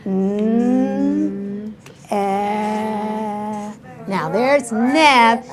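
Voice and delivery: each sound held long, then blended; said slowly. Shown as a demonstration. Teacher shows how to hear sounds, saying them very slowly. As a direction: stretch every sound out for spelling. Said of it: "So I'm gonna say it slowly".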